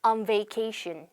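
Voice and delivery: "On" with an open ah-like vowel, then "vacation"; correct pronunciation. Shown as a demonstration. Teacher modelling "On vacation" for students. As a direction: keep "On" between ah and oh. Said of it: "이런 느낌이 아니라".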